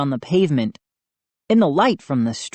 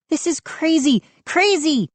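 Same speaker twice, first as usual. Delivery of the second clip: exasperated; 12 semitones higher than usual. A narrator's reading. Mindy freaking out.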